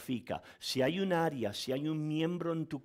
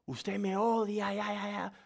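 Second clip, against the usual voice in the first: mock angry outburst; 6 semitones higher than usual. Disciple cursing the pastor, mock enraged cry. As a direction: play the enraged disciple lashing out. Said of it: "me maldijo y se enloqueció".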